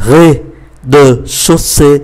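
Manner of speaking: Rez-de-chaussée read very slowly, every syllable apart; very slow French teacher model of Rez-de-chaussée; slow careful reading. Shown as a demonstration. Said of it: "đọc nhanh là rez-de-chaussée".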